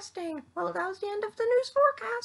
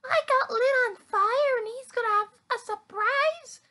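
ordinary delivery

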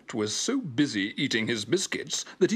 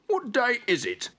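high, puzzled puppy voice; Spot the dog; 12 semitones higher than usual. A narrator's reading. Spot asking his laughing friends, high puzzled pup. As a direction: ask it pitched well up, baffled.

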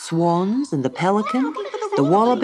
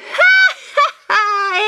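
high, loud cackling bird call; loud kookaburra laugh; 6 dB louder than usual. A narrator's reading. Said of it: "laughed the kookaburra".

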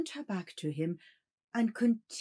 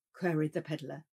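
plain reading voice

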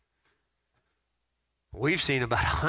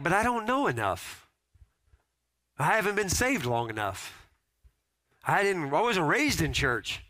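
higher, hesitant voice of an unsure believer; mock doubtful churchgoer; 7 semitones higher than usual. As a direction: play the nervous excuse-maker, pitched up. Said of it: "And you're like".

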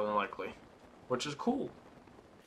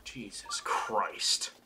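exhausted whisper; 2 semitones lower, 6 dB quieter, much slower than usual. Real, not acted. Relieved after ordeal.

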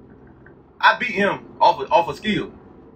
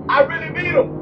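higher, proud boast; excited brag; 9 semitones higher than usual. Real, not acted. Racer brags to viewers about beating Demonology, excitedly. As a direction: crow about the win. Bragging after the race.

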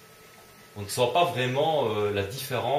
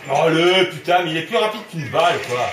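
high, loud outburst of frustration; loud exasperation; 4 semitones higher, 8 dB louder than usual. Real, not acted. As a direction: protest loudly in mid-fight. Outrun by enemy in gunfight.

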